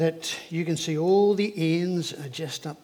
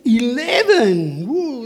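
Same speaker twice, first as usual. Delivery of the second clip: excited exclamation; 5 semitones higher, 4 dB louder, much slower than usual. Real, not acted. Excited reply to child's age.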